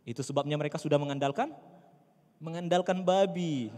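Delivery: normal talking voice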